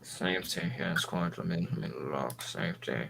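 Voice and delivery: low, soft, proper English gentleman; Sherlock Holmes voice. Acted. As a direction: play the polite English butler, hushed. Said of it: "What's with the Sherlock Holmes shit?".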